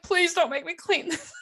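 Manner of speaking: high, laughing imitation of begging; mock pleading. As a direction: beg in a put-on high voice. Acting out co-worker begging.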